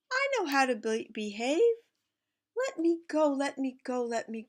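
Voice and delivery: a small pot begging to be let go; little pot pleading. Acted. Little pot pleading with mama to go.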